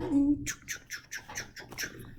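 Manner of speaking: goofy vocal beat; dance noises. Acted. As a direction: make silly rhythmic train-like dance sounds. Imitating his silly dance noises.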